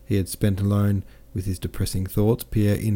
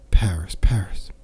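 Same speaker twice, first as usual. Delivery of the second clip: reverent whisper; 4 dB louder than usual. Acted. Captain dreams aloud of Paris, a rising whisper. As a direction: whisper the name twice, lingering, rising. Captain breathes the city's name.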